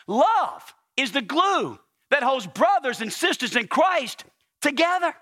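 slow, drawn-out preacher cadence; emphatic preaching. Acted. Preacher telling church love is the glue, emphatic. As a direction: stretch each key word and let it land.

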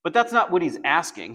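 ordinary talk, nothing marked

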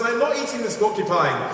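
high-pitched, hammed-up excuse; mock protesting. Hunter excusing himself to his accuser, mock protesting.

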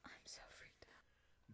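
scared whisper. Real, not acted. Scared during ritual.

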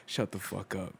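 low, quiet, stunned murmur of disbelief; hushed disbelief. Retold or imitated. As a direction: mutter it to yourself, quietly awed. Retold disbelief at the sighting.

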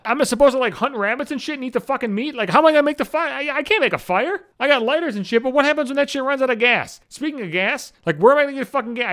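mock panic. Sitting in the dark, imagining apocalypse survival, mock panic. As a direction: spiral into panic at every question.

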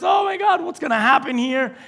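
high-pitched mock panic; nervous. Preacher voices Rebecca fearing the fallout, nervous. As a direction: act out a mother fretting, pitch jumping up. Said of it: "Rebecca's nervous".

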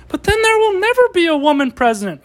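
high nasal-free sneering heckler voice; hammed-up high objection; mock whiny objector. Mocking an objector.

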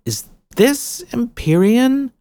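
put-on high, slow, uncertain girl; timid question. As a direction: ask shyly, stretching it out. Jill asks, unsure.

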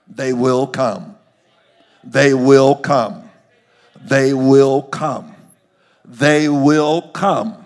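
THEY WILL COME, loud, each word drawn out slowly; loud, slow, weighty repetition with pauses between; emphatic warning. Real, not acted. Preacher hammers repeated warning.

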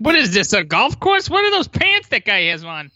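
mock indignant New Yorker; New York accent. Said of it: "our terrible New York accents".

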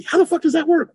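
high, incredulous outburst; baffled. Real, not acted. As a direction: throw the question up high, incredulous. Baffled rhetorical question about beer.

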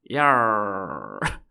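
long, low pirate yarr, falling. Pretend. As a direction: growl a drawn-out storybook pirate cry. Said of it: "Enter our pirates".

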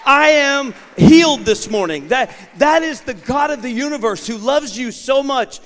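high, loud, triumphant preaching shout; preaching at full force. Acted. Preacher proclaiming his healing to the congregation. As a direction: ring it out in celebration.

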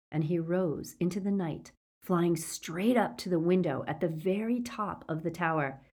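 ordinary delivery

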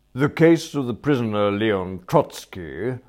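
loud, formal court voice; stern magistrate. Acted. Magistrate turning to the next prisoner, loud and stern. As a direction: announce the case with authority.

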